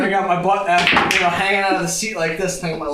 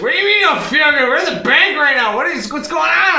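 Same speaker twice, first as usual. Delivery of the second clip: high, loud, fast exasperated wife; wife's voice; 8 semitones higher, 4 dB louder than usual. Retold or imitated. Wife in the bank lot scolding husband, high and loud. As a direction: fire it off like an alarmed wife. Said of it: "She said".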